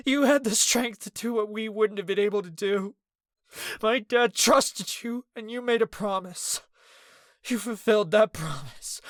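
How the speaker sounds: high and loud through tears; sobbing. A narrator's reading. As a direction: keep crying while you forgive him. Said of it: "Ez continued to sob".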